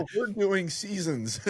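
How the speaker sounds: high, slow mock announcement; teasing mimicry. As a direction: draw it out high, poking fun. Mimics co-host announcing seasons, teasing.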